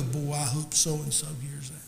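low, soft mock aside; under-the-breath churchgoer's remark; muttered aside. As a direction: imitate a listener murmuring privately in the pew. Imitating a listener's private remark.

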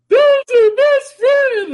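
high drawn-out mock comforting voice; mock the friends who pity him, slow and high; mock sappy friends. Friends mocking him at sad movie.

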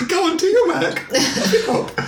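high mock boss voice. Playful imitation of the boss.

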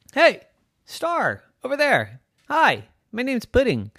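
high, eager hail; calling out. Acted. Lost Pudding calls to a passing star, eager hail. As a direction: call brightly across a distance, in short bursts.

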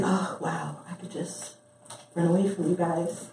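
soft, dreamy daydream aloud; hushed inner thought. Retold or imitated. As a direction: murmur it like a secret wish. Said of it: "I just sit there and I think".

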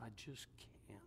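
broken, whispered I can't; barely a breath, choking back tears; weeping whisper. Retold or imitated. Husband at wife's deathbed, whispering through tears. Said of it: "he began to weep".

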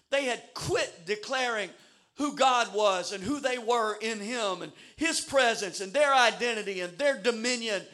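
normal talking voice

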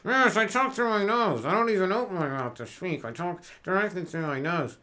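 mock English, mouth barely open; nasal English accent. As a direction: push English words out through the nostrils. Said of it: "words are coming out of your nostrils".

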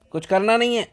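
high, loud stop command; stern order. Retold or imitated. Servant sternly forbidding the master's boy, loud and high.

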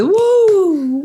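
long high "woo" whoop; drawn-out, high-pitched crowd whoop; imitated crowd cheer. Crowd cheering the supposed mariachi.

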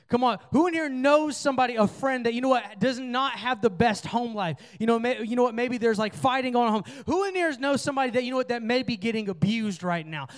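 rally the room toward action; rising preaching. Acted.

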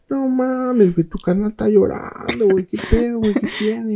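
voz aguda de asombro burlón; amigos sorprendidos. Retold or imitated. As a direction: exclama con asombro. (amigos asombrados al verlo llorar, voz aguda)

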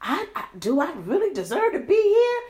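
high-pitched, mock self-questioning; self-doubting question. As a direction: voice someone doubting they belong. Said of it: "you know, you're like".

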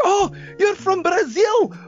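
high-pitched, starstruck fan imitation; getting excited. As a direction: mimic an overexcited drunk football fan. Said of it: "getting excited".

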